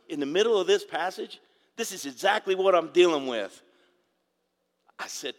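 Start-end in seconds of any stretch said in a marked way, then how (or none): 0.1-3.6 excited son. Retold or imitated. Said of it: "He goes".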